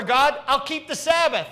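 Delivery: high, loud, fast mock believer bargaining with God. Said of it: "worship God as if he is Rover".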